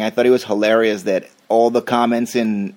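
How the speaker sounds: ordinary delivery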